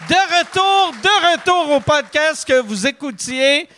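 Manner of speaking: high, loud mock host voice; hammed-up back-from-break announcer.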